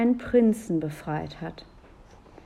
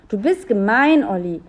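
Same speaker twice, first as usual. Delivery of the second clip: indignant, raised-voice accusation; cross accusation; 3 semitones higher, 7 dB louder than usual. A narrator's reading. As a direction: scold a little brother. Julia scolds her brother.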